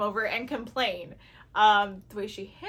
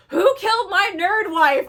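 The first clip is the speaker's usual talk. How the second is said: hero's outraged cry; 8 semitones higher, 9 dB louder than usual. Retold or imitated. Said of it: "when he's like".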